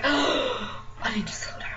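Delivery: slow, breathy whisper to herself. Retold or imitated. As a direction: whisper it as if overwhelmed. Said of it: "you're just like".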